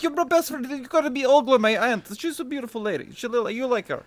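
put-on high, slowing Polish-accented voice of a painter; terrible Polish accent. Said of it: "That's a terrible Polish accent".

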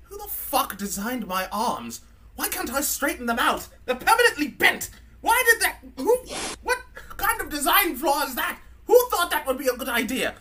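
high, whiny, slurred British robot; drunk C-3PO. Acted. Comedy podcast host voicing a drunk C-3PO ranting about his arms. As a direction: rant like a drunk, bitter protocol droid. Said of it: "First thing he says is like".

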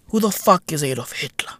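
fast whispered repeat; conspiratorial whisper. Acted. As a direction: hush it right down.

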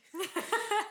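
sudden high embarrassed laugh; high burst of laughter. Real, not acted. Laughs at own panic.

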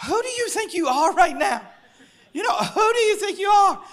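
high-pitched, incredulous mock scolding; mock telling-off, voice pitched right up; scolding parent. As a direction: play a parent appalled at the kids. Parent scolding kids, acted.

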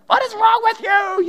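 high, loud mock outburst of exasperation; hammed-up shout of frustration inside his head; inner shout. Pastor inwardly yelling at a teen over a broken phone, mock shout. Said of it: "in my heart, I'm like".

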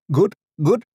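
put-on high, loud Indian-accented doctor; thick Indian accent. A narrator's reading. Doctor examining a patient's eyes, high voice with thick Indian accent. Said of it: "he said in a thick Indian accent".

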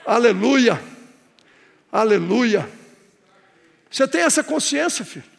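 ordinary delivery

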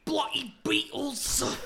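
slow, very high British squawk; mock British accent. Shown as a demonstration. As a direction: draw it out in a silly high British voice. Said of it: "our British accents are better than".

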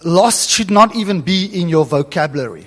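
usual speaking voice

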